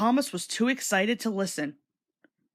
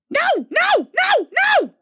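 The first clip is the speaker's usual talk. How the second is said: very high, loud squeaky grumble; grumbling trucks; 8 dB louder than usual. A narrator's reading. Silly trucks grumbling back at Thomas, squeaky and cross. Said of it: "answered the trucks".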